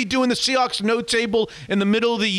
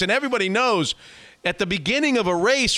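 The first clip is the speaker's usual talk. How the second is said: normal talking voice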